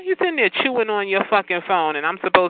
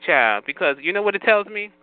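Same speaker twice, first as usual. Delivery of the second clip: usual speaking voice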